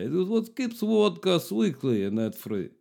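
put-on a light Polish accent on the podcast plug; lightly put-on Polish accent; mock Polish accent. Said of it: "I just went Polish there".

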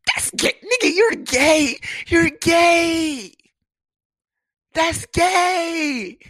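high, loud yell; loud high mock outrage; shouted accusation. Real, not acted. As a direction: shout it at him in disbelief.

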